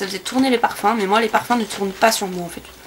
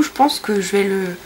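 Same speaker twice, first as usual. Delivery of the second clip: ordinary delivery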